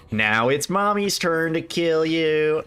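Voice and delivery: put-on slow, eerie child voice quote; creepy child line. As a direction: lightly mimic a creepy kid. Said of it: "That line in English, fucking hate it".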